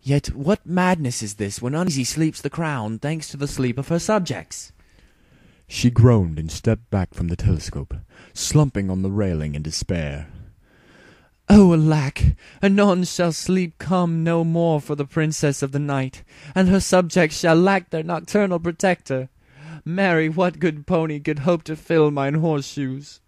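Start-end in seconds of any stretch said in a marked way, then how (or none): 0.0-4.7 higher, regal exasperated princess; a weary royal complaint; Princess Luna voice. Acted. Luna lamenting. 11.5-23.2 loud, high, theatrical royal despair; hammed-up regal lament. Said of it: "she sighed".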